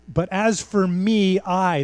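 ME and I drawn out slowly with force; emphatic. Acted. Preacher voicing Micah hammering the point, emphatic. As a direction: hammer "me" and "I", slow and weighty. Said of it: "this is really emphatic here".

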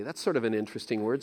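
usual speaking voice

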